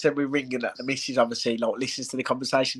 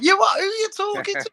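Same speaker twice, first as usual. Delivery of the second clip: mock outrage; 4 dB louder than usual. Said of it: "He'd be like".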